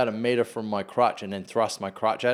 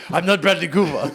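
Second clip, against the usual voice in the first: a hint of a grumpy backpacker, higher and louder; mock German backpacker; 6 semitones higher, 7 dB louder than usual. Mate imitating the backpacker's indignant denial. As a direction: lightly act the annoyed backpacker. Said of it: "And he was just like".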